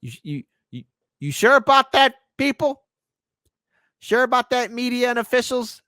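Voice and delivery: pointed, doubting question up high; incredulous challenge. Real, not acted. Challenging media and officials' claims.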